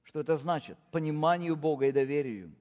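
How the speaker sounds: ordinary talk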